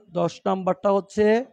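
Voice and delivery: normal talking voice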